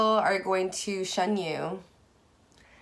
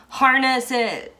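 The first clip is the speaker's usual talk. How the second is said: yelled rallying cry; 7 semitones higher, 8 dB louder, slower than usual. Real, not acted. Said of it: "I'm, like, yelling".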